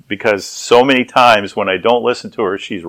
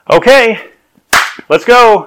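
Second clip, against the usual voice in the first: high, loud summons to leave; chirpy wife; 11 semitones higher, 5 dB louder, slower than usual. Retold or imitated. Wife calling husband out to dinner, brightly insistent. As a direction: imitate a wife calling out, ready to go.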